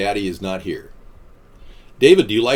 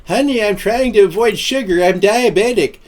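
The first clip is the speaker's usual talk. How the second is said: grandma voice; 11 semitones higher, 6 dB louder than usual. Retold or imitated.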